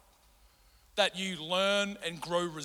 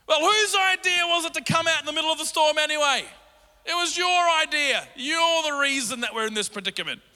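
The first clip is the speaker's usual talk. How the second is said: accusing disciples; 7 semitones higher, 5 dB louder than usual. Acted. Disciples blaming Jesus in storm.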